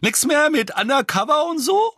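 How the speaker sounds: high, loud, outraged shriek; furious high-pitched yelling; screaming. A narrator's reading. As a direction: shriek it in a scolding old-woman voice. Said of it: "schrie Misses Figg".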